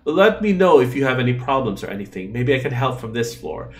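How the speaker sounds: normal talking voice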